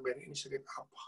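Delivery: a quiet aside to himself; hushed inner question. Retold or imitated. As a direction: murmur it under the breath.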